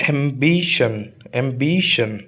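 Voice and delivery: Ambition with a long stressed "बि"; stress on second-last syllable. Shown as a demonstration. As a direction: hold the "बि" in ambition as "bee", falling on "शन". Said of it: "जहाँ पर stress आता है उसको louder". (Ambition में "बि" पर खींचकर ज़ोर)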